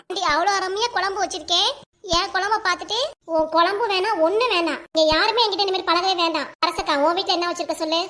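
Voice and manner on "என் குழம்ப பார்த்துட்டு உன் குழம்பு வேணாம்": ordinary talk, nothing marked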